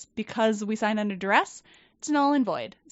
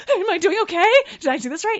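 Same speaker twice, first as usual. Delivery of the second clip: much higher, louder nervous question; anxious pleading; 9 semitones higher, 7 dB louder, faster than usual. Retold or imitated. Nervous employee checking with her boss, in a high anxious plea. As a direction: beg for reassurance, jittery.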